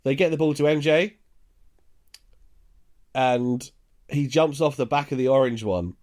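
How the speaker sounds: ordinary talk, nothing marked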